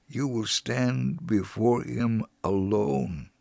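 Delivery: ordinary talk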